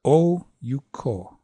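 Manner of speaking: "Oh, you, ko" as long, short, long. Shown as a demonstration. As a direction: stress Oh, shorten you, land on ko. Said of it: "you could go long, short, long".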